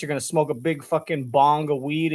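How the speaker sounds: normal talking voice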